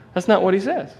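ordinary delivery

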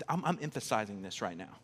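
normal talking voice

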